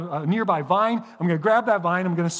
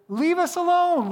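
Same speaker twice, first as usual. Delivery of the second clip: "alone" pitched much higher, drawn out slowly and falling; mock whiny sarcasm; 11 semitones higher, 3 dB louder than usual. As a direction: whine "alone" up high and slow like a mocking complaint. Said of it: "they were being sarcastic".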